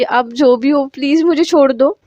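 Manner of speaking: ordinary delivery, nothing marked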